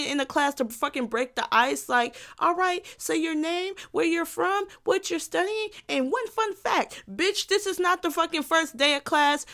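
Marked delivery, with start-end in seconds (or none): 2.4-7.0 higher-pitched classroom roll-call spiel; mock icebreaker leader. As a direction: imitate a teacher listing icebreaker questions, pitched up. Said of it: "It's giving icebreaker".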